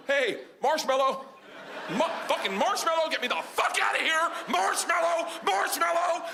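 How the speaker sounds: high, urgent acted yelling; panicked calls for help. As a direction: cry out the safe word in mounting panic. Panicked calls for rescue from bear.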